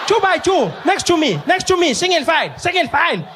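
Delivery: put-on a teacher shouting at runaway kids, high and frantic; panicking teacher. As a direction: yell like you're losing control of the class. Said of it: "She was like".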